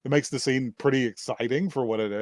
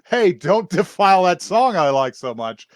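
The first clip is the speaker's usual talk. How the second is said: louder, higher mock call-out; raised-voice retold protest with a laugh in it; imitated bystander's protest; 5 semitones higher, 6 dB louder than usual. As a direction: call out the objection openly, half laughing. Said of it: "somebody beside her says".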